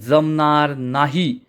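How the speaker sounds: usual speaking voice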